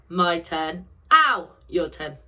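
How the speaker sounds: ordinary talk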